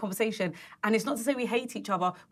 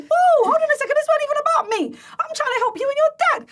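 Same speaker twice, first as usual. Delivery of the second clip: shocked protest; 9 dB louder, much faster than usual. Retold or imitated. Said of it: "And I was like, harpoon to the chest".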